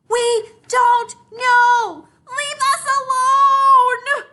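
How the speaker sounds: very high, loud, slow exasperated yell; shouting. Acted. Siblings shouting at their pestering little sister, exasperated. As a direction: yell at her to leave them alone. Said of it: "they shout".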